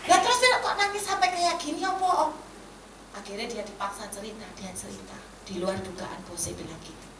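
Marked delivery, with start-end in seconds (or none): 0.1-2.3 high-pitched mock disbelief; insistent boss. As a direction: keep pressing in a raised voice. Boss keeps interrogating employee.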